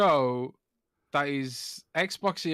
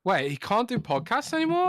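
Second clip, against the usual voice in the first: surprised; 10 semitones higher, 3 dB louder than usual. Real, not acted. Learning the guest cannot come.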